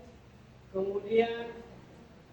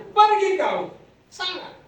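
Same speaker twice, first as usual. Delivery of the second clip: higher, louder furious dismissal; angry shout; 10 semitones higher, 8 dB louder, slower than usual. Acted. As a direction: chase her off with a shout. Musa drives the woman away.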